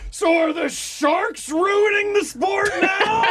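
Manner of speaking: slow, high-pitched mocking wail; mock fan outrage. Mocking rival fans who blame the Sharks, wailing. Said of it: "fans who were like".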